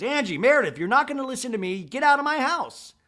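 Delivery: high, loud imitated tirade; hammed-up screaming order; mock scream. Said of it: "she screams".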